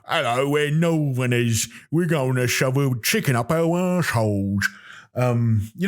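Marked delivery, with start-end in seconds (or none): 0.1-4.7 put-on northern English accent. Comedian mimicking people up north, broad Northern English accent. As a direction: caricature a Northern English local. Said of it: "you go up north".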